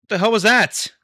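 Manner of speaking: high, loud yell of shock; real startled yell; shouting. Real, not acted. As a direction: yell it at the screen. Yelling at crashing rocket.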